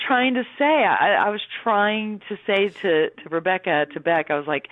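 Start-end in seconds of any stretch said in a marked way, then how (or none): none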